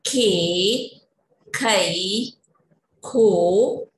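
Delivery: slow paced teacher chant, each syllable set apart; syllable drill recitation. Shown as a demonstration. Teacher leading children through the क row, slow recitation. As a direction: recite the vowel chart for little kids.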